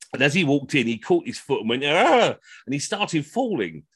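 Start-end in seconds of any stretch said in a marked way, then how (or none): 1.8-2.3 yelp. Retold or imitated. Old man trips at step.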